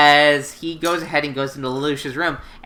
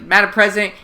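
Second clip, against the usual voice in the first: ordinary talk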